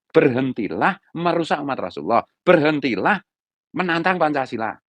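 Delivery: stern, hard-hitting demand to stop; firm and harsh. Shown as a demonstration. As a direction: hammer each demand home forcefully. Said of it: "Saya tegas keras".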